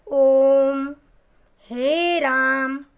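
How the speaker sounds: chant-like drawn-out "ओम"; prolonged pluta vowels. Shown as a demonstration. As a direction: hold "राम" out three times as long. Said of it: "तिगुना समय लगता है".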